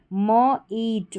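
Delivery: very slow, split into म and ईट; sounded out. Shown as a demonstration. Teacher sounding out मीट for students very slowly. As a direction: draw each sound out. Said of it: "मीट रहेगा".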